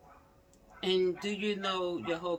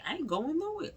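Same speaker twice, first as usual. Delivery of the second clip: mock gangster; 12 semitones higher, faster than usual. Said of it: "you like gangster".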